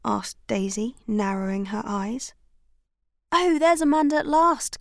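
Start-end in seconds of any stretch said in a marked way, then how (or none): 3.3-4.8 raised, loud happy call; eager cry. A narrator's reading. Bertie spotting Amanda, calling out eagerly. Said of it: "cried Bertie".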